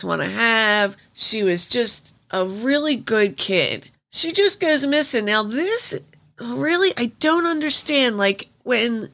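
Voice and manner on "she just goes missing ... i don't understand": incredulous. Real, not acted. Incredulous over ignored missing girl.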